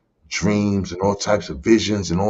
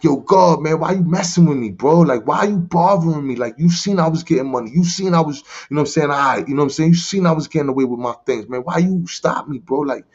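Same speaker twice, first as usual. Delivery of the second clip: mad at God; 6 semitones higher than usual. Retold or imitated. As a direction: rant at God for ruining things. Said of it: "I'm mad at God".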